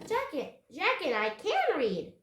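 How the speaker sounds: put-on high, loud, eager child; piping up. A narrator's reading. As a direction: pipe up proudly over the grown-ups. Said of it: "Annie piped up".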